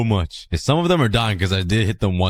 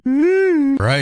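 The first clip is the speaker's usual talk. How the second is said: high excited drawl. Real, not acted. Player to viewers, hyping the big moment. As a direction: build hype.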